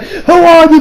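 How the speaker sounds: retold startled challenge. Guys meeting Joe for the first time, startled. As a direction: act out a sudden, alarmed challenge.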